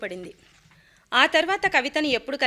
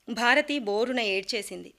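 ordinary delivery

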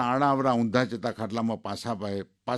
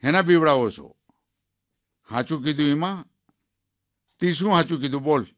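put-on old wife answering back, louder and higher; lightly voiced old woman standing her ground; defiant retort; 4 semitones higher, 5 dB louder than usual. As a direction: challenge him to say it.

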